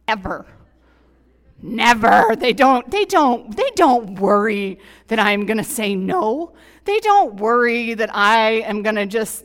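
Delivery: loud, impassioned sermon voice; preaching at full force. Real, not acted. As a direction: preach it out to the whole room.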